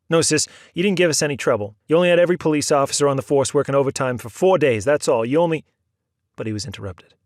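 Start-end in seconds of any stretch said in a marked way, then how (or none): 0.1-5.7 loud, fast, biting sarcasm; angry sarcasm. A narrator's reading. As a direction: lay into her sarcastically.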